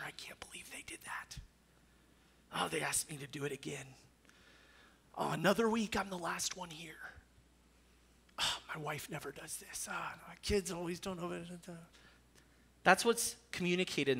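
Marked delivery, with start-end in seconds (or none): 0.1-11.3 softer, whispered grumbling; muttering under breath. Retold or imitated. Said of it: "muttering dissatisfaction under one's breath".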